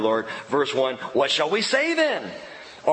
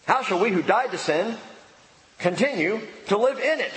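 brisker, insistent questioning; pressing rhetorical question with energy; legalistic response; 3 semitones higher than usual. Acted. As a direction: put the question as a challenge. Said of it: "The first response is the legal response".

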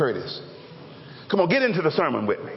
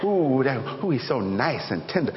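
lower, swooning mock admiration; swooning woman; 7 semitones lower than usual. Preacher mimicking a woman admiring a tender man, swooning. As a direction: sigh over a tender man, mockingly. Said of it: "you sit there and you go".

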